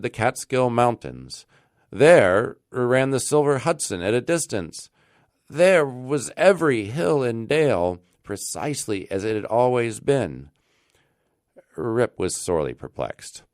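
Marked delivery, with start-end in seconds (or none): none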